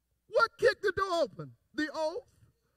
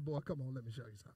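soft God voice; 11 dB quieter, much faster than usual. Retold or imitated. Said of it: "He said".

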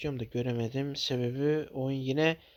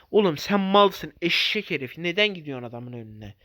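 furious shout; 6 dB louder than usual. Real, not acted. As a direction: bawl out the idiot teammate. Yelling at dumb teammate.

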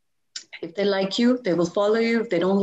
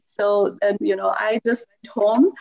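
ordinary delivery

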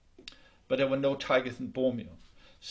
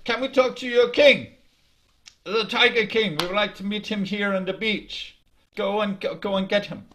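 louder and higher, a bossy tiger giving orders; loud demanding tiger; 5 semitones higher, 7 dB louder than usual. Acted. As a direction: boom it out like a tiger ordering a small deer. Tiger demands to meet king.

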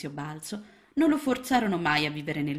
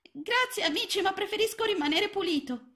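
put-on very high, small child-frog voice; a tiny lost frog, unsure; little frog. A narrator's reading. Lost little frog answering Mrs Balzelli, high and small.

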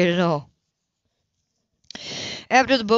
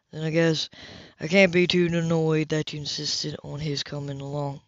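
lower, resigned grumble; a hint of a man conceding with good grace; dry male voice; 5 semitones lower, 3 dB quieter than usual. A narrator's reading. Lower voiced character line.

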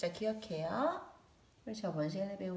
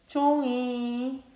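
slow, clear word; 6 semitones higher, 7 dB louder, much slower than usual. Shown as a demonstration. Names supply for children.

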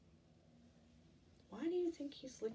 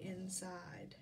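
whisper; 4 semitones lower, 8 dB quieter than usual. Acted. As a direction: whisper the last word. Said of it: "storyteller drops to whisper".

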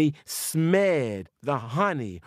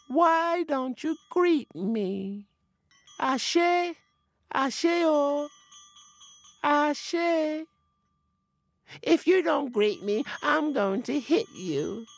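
high spirit voice, slow and insistent; a high little spirit making threats; demanding forest spirit; 11 semitones higher, slower than usual. Acted.

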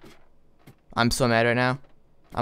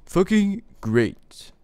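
very slow, dragged-out sarcastic praise; 8 semitones higher, 4 dB louder, much slower than usual. Real, not acted. Gamer mocking his own bad luck after losing everything, sarcastic. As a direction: stretch it out, dripping with irony.

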